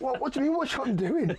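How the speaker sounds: high-pitched incredulous question; retold bafflement. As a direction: act out baffled disbelief at the warning. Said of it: "I was like".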